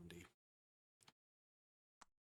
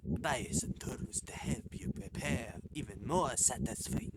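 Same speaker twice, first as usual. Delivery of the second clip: badly faked French accent, welcoming host; hammy French restaurateur reading aloud; French chef host; 3 semitones lower, 3 dB louder than usual. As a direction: give the tour as a mock-French chef. Reads French chef character's lines.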